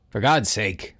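ordinary delivery, nothing marked

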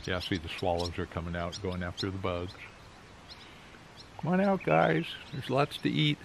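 talking around pipe stem; pipe in mouth. Real, not acted. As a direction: lips clenched on pipe. Seen 1: Pipe stem held in teeth in profile while he speaks.

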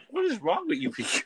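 high, incredulous cry; exasperated disbelief. Retold or imitated. To the drunk friends at the party, high incredulous exasperation. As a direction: throw up your hands at them.

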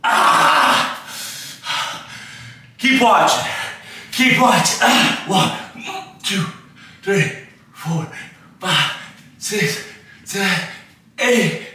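strained counting mid-rep; doing push ups. Real, not acted. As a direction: push the words out under effort. Counting reps mid push-up set.

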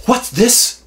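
high, loud mock interrogation; mock accusing uncle. Uncle confronting the boy over a crumb, mock stern. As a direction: ham up the gotcha question. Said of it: "and he's like".

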